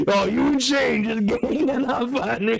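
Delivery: mock his complaint while cracking up; laughing mockery. Mimicking the friend's complaint to Shane while laughing.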